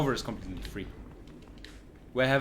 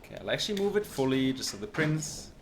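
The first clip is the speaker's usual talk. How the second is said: ordinary talk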